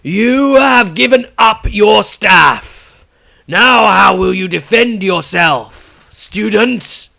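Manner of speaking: put-on high, slow, booming old wizard lord; loud booming voice. A narrator's reading. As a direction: boom it slowly from everywhere at once. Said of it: "The Patriarch's disembodied voice rang out again".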